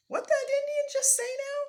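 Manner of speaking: high, loud puzzled question; French-Canadian accent, baffled; puzzled French-Canadian lumberjack. Acted. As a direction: call out bewildered.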